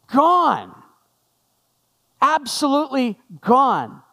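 Gone said high and drawn out, then Absolutely gone; stress on gone. Shown as a demonstration. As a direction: linger on the word Gone. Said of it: "passed out".

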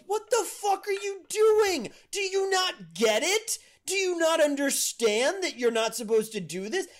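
high, loud exasperated scolding; yelling at cat. Retold or imitated. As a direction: re-enact yelling at a disobedient cat in disbelief. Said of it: "would yell at my cat".

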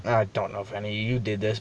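normal talking voice

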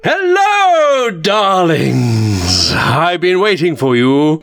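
high, slow campy game show host; campy drag queen. Pretend. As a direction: purr it like a drag queen hosting. Quiz host intro in put-on voice.

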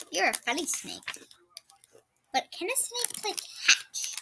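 normal talking voice

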